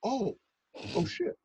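soft, high "oh" of dawning embarrassment; sheepish realization. Retold or imitated. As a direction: let the mistake sink in, softly. Realizes he misread the greeting.

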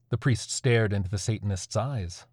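ordinary delivery

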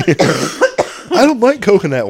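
put-on high, slow, whiny little boy; little kid voice. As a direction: play the small son insisting. Imitating little son.

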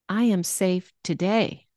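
"today" set apart and stressed. Shown as a demonstration. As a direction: land firmly on "today" at the end. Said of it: "how it sounds with word emphasis".

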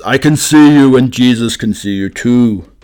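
lightly voiced, spooky call from nowhere; mysterious voice in the dark. Voice in the dark warns the burglar, loud and eerie. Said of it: "from the darkness comes the voice".

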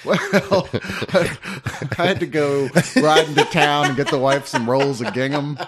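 put-on laughing old-timer voice; old frontier codger. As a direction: play a crusty Western homesteader. Jokes about being old.

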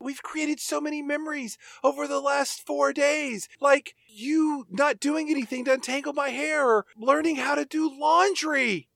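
high-pitched sarcastic goodbye voice; high mock farewell. [name]'s character bidding goodbye, high sarcastic sweetness. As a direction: pipe it up sweetly but sarcastic.

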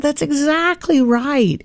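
put-on high, slow, drawn-out praise for a little girl; a mother's high, slow "good job" voice; sing-song praise to a child. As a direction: sing it out to a kid who just got it right. Mother praising her daughter.

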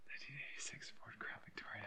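breathy full whisper; whispered mock repeat of the car name; funny accent. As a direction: hush it out like a secret. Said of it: "Funny accent".

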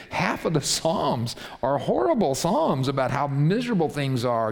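usual speaking voice

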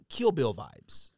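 ordinary talk, nothing marked

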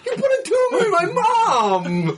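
very high mock wail; squealing, exaggerated cry of fury; anguished outrage. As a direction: wail it like a hammed-up betrayed son, last word stretched out. Acting out hero's anguished outburst.

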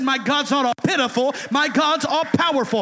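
preacher shouting it back to the church; preaching at full force. Acted. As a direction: hammer it home the second time. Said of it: "I said".

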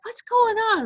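high, sugary nurse greeting; sweet nurse voice. Retold or imitated. Nurse coos at an upset patient, high and sugary. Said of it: "she came in super sweet".

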